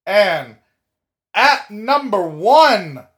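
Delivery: slow, loud build to the name; dramatic reveal. Acted. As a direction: announce it like a ring announcer. Dramatic number one reveal.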